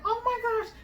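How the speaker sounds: high, hushed gasp of amazement; high-pitched retold shock; retold astonishment. Reliving moment baby comes out.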